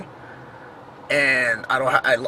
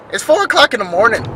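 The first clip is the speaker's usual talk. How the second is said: incredulous; 9 semitones higher, 6 dB louder, faster than usual. Retold or imitated. Incredulous protest to stranger.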